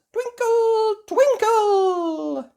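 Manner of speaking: high, stretched-out chant; magic spell. A narrator's reading. Holly waving her wand, high magical chant. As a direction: cast the spell, drawing each word out.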